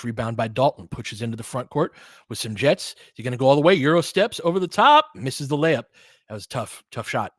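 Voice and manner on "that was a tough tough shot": normal talking voice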